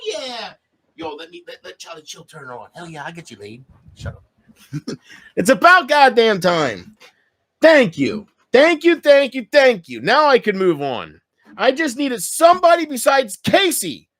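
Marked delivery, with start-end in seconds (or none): 5.4-14.1 loud, high gushing thanks; overjoyed thank you, thank you; excited relief. Real, not acted. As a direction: pour out grateful relief.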